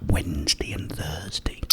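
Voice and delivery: normal talking voice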